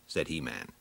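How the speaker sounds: plain reading voice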